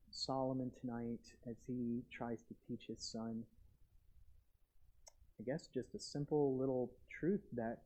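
soft, low prayer to God; closing prayer. Acted. Pastor closing the sermon in quiet prayer to God, soft and low. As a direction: pray quietly over the congregation.

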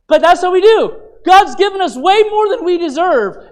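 fervent preacher's call; preaching at full force. Acted. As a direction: drive it home from the pulpit. Preacher pressing the congregation.

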